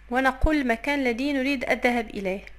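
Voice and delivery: ordinary delivery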